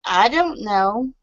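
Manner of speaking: ditzy blonde. Shown as a demonstration. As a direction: play the clueless blonde. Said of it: "act like you have blonde roots".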